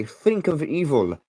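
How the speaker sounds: usual speaking voice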